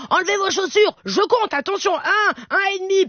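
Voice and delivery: high-pitched mum counting as a warning; exasperated mother. Retold or imitated. Mother tells her children to take shoes off, exasperated.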